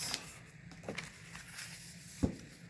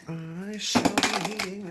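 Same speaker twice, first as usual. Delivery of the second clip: mock grand; 4 semitones higher, 7 dB louder, slower than usual. Mock formal announcement.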